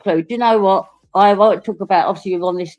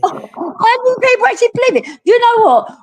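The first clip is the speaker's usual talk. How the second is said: high, disbelieving squeal; sky-high disbelief; incredulous. Real, not acted. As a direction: shriek it in disbelief. Incredulous rant about the story.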